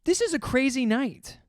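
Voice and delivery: high, slow, incredulous; mock disbelief. To coworkers on a wild night, high, slow mock disbelief. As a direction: draw it out in mock amazement. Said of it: "I'm like".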